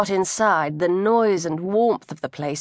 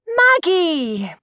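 higher, louder call across a crowded bar; 10 semitones higher, 4 dB louder than usual. Acted. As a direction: hail a friend over the noise. Candace calls across bar.

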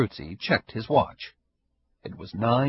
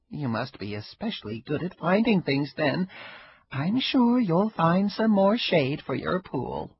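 higher, lightly voiced old woman, wry and amused; old Ida, sly; 7 semitones higher than usual. Ida needling her neighbor, a higher sly old-woman voice. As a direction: play the sly old neighbor enjoying herself.

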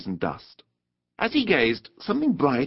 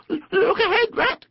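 very high, alarmed warning; sudden cry; 4 dB louder, slower than usual. A narrator's reading. Mole sees the bank coming, alarmed cry. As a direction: squeal an urgent warning. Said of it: "cried the Mole suddenly".

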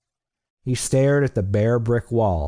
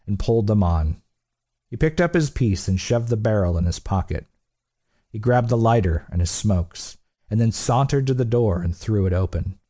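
plain reading voice

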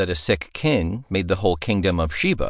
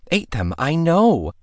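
high, gleeful crow; 11 semitones higher than usual. Acted. Princess gloating over the ant victory, crowing. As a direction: crow it with glee. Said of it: "Anakia crowed".

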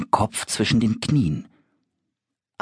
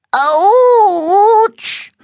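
sehr hoher, lauter Schmerzensjauler; laut aufjaulen wie nach einem Tritt an den Bettpfosten; aufjaulen vor Schmerz; 9 dB louder than usual. Acted. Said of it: "jault das kleine Monster". (das kleine Monster jault vor Schmerz, sehr hoch und laut)

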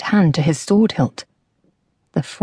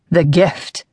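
rasped. Acted. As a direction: rasp the words out like a threat. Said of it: "rasped the horseman".

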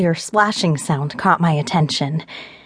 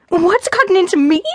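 angry echo; 13 semitones higher, 3 dB louder than usual. Acted. She echoes him angrily.

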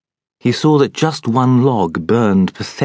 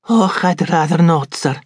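higher, hurried refusal; quick Scots maid; 8 semitones higher than usual. A narrator's reading. Maid quickly declines the doctor's order. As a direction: refuse fast and nervously. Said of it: "Betsy said quickly".

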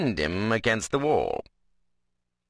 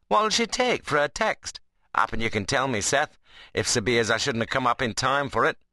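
teasing rustic dialect, mock-scriptural at the end; Ben, cheeky workman; 6 semitones higher than usual. Ben teases Seth about the preacher, mockingly. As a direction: play a workman poking fun at preaching. Ben's figure voice.

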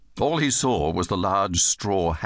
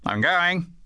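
higher-pitched impatient warning; annoyed father; 9 semitones higher than usual. Acted. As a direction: warn the dawdler you are leaving. Annoyed father threatens to leave.

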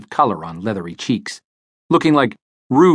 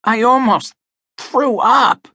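offended; 11 semitones higher, slower than usual. A narrator's reading. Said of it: "He sounded offended".

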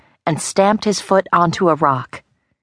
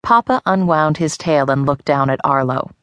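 usual speaking voice

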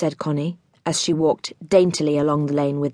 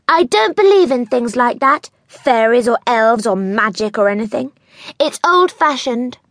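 lightly voiced haughty town girl, a bit higher; scornful girl Connie; 6 semitones higher, 4 dB louder than usual. A narrator's reading. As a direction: sneer lightly at the silly tales. Connie dismissing magic.

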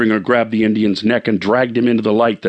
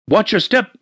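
mild shout; 10 semitones higher than usual. Acted. Man warns woman on wet stairs, mild shout. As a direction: warn her sharply on the stairs. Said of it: "shouted the man".

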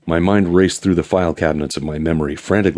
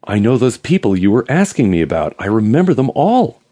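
higher and louder, eager; nearly panting excitement; 6 semitones higher, 5 dB louder than usual. Acted. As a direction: spill it out, thrilled. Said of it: "He was nearly panting".

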